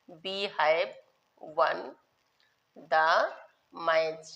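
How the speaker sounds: one word at a time; long pauses between the words of the example; slow dictation. Shown as a demonstration. Teacher dictating an English example to pupils, slow dictation.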